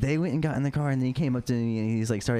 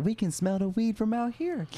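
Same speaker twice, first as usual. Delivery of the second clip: mock officer voice pitched way up; imitating the cop; 12 semitones higher than usual. Cop telling the boys he smells weed, high mock voice. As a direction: do the cop smugly, in a high put-on voice. Cop quoted during traffic stop.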